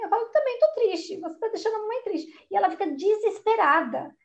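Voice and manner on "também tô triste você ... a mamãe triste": high, put-on tearful whine to the daughter; mock crying. Mom pretending to cry to stop a tantrum, high and whiny. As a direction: mom fakes sadness, whimpering higher. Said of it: "eu começo a chorar também".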